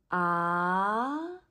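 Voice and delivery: Ah rising like a question; second tone. Shown as a demonstration. Teacher showing the rising tone, Ah going up. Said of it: "Second tone is a rising tone".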